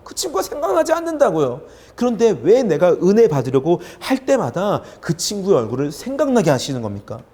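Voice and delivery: high, loud exasperated protest; arguing with God. Retold or imitated. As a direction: plead indignantly, pitch leaping up on each complaint. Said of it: "하나님께 따집니다".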